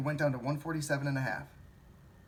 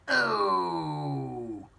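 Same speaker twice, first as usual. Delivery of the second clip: a louder, slightly higher mock gasp of shock; mock dismay; 4 semitones higher, 7 dB louder than usual. Imitating shareholders finding their losses, a mock shocked "Oh!". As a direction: act out the moment they see the loss. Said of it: "And they're like".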